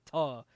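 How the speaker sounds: "ta" as a clipped slang "to". Shown as a demonstration. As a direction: say "ta" with a loose uh vowel. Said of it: "it's T A, like".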